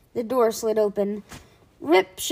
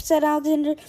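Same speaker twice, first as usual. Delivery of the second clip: ordinary delivery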